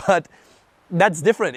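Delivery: normal talking voice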